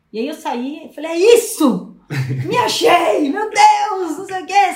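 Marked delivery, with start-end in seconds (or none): 1.1-4.7 excited. Retold or imitated. Retelling her breakthrough joy.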